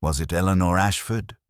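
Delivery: ordinary delivery, nothing marked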